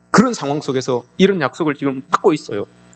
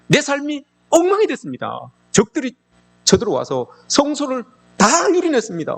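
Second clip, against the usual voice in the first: high, crying-out lament; anguished lament; 10 semitones higher than usual. Acted. A prophet crying out to God, high anguished lament. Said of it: "십팔 절에서도 또 고백하죠".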